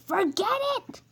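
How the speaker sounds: Ted fed up with the driver; exasperated Ted. Acted. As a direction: wave it off crossly.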